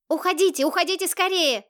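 high-pitched urgent call at moderate volume; boy urging them to get away; urgent cry. A narrator's reading. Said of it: "закричал Ник".

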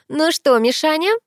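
loud, high, rising hail; acted bark. A narrator's reading. Sister hails the waiting guest, barking it out. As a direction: call out boisterously. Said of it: "гаркнула Ангелина".

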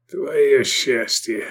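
slow, strained wheeze; hoarse, drawn-out threat; hoarse croak. A narrator's reading. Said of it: "прохрипел Мирарзал".

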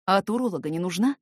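plain reading voice

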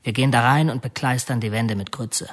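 usual speaking voice

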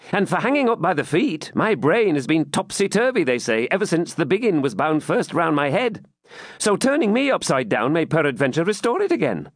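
high, impudent fool's voice; cheeky jester. Acted. Captive jester mocking the baron, high cheeky voice. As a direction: toss off the quip like a jester.

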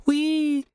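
put-on very high excited child squeal; delighted squeal. A narrator's reading. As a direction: squeal with joy like a kid. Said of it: "взвизгнула девчонка".

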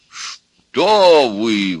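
loud, drawn-out protest; exclaiming. A narrator's reading. Bride's father reassuring the suitor, loud exclamation. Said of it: "воскликнул отец невесты".

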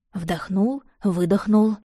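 plain reading voice

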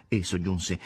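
plain reading voice

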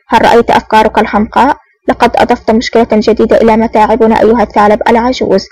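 ordinary delivery

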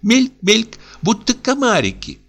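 put-on high, bright childish wonder; excited little girl. A narrator's reading. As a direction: marvel at the angels like a small child.

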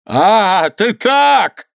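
indignant cry climbing high; outraged squeal. A narrator's reading. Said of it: "завизжал воевода". (возмущённый визг)

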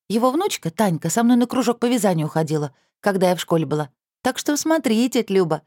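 put-on teasing teen girl. A narrator's reading. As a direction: tease like a cheeky niece. Teenage niece voiced.

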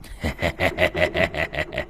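put-on sinister old man cackle; low eerie laugh; low creepy chuckle. A narrator's reading. Sorcerer laughs again.

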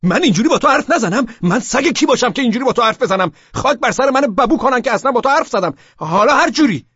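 loud, high sarcastic yell; exasperated shout. A narrator's reading. Narrator ranting at Laleh in exasperation, loud and high. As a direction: rant it in exasperation. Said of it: "با کف دستم ... کوبیدم و گفتم".

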